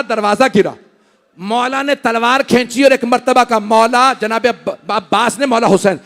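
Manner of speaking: forceful, ringing narration of a sacred drama; impassioned preaching. Acted. Preacher telling mourners of Abbas at the door, impassioned.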